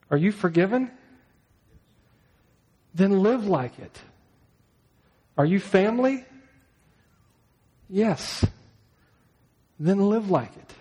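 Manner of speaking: earnest pulpit exhortation; preaching challenge. Real, not acted. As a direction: press the congregation to answer.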